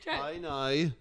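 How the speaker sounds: Northern Irish accent. Shown as a demonstration. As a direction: correct them with the Northern Irish vowel. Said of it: "Northern Irish accent".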